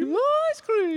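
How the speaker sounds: shrieky-high mock British lady; loud falsetto British woman; British woman accent. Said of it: "a woman with a British accent".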